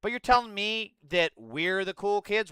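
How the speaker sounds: ordinary delivery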